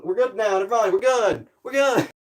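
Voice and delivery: usual speaking voice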